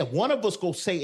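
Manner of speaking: ordinary delivery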